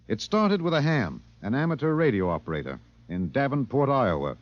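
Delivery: dramatic radio host narration; measured broadcast storyteller. A narrator's reading. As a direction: set the scene like an on-air editor. Narrator resumes the show.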